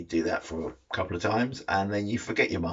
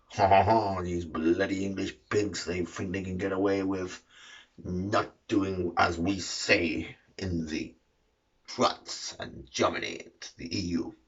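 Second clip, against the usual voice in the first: hammed-up French fisherman with a haughty laugh; comic French accent, indignant and scornful; mock French accent; 3 dB quieter than usual. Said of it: "the French fishermen, old Pierre and Jean-Michel, are like".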